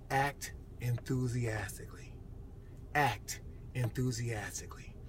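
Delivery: slow, soft, deliberate command; hushed urging. Real, not acted. Speaker urging viewers who have desires, hushed and earnest. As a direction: press it home quietly, word by word.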